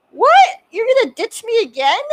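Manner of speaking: high, loud imitated protest; hammed-up shocked whine; mock outrage. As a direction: act the star feeling betrayed in fun. Star protests being ditched, retold.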